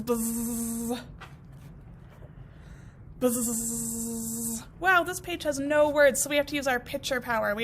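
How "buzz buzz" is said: low drawn-out buzzing; lawnmower buzz. Acted. Teacher voicing the lawnmower, low buzz. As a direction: hum like a mower. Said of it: "it's going buzz".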